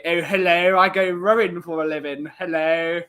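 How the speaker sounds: hammed-up posh Tunbridge Wells accent; mock posh accent. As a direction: do a silly upper-class English toff accent. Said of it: "dating someone from near Tunbridge Wells".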